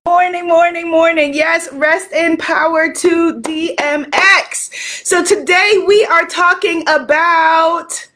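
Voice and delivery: hyped-up show opener; high-energy livestream welcome. Real, not acted. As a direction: greet the crowd with big, punchy energy. Hypes up livestream opening.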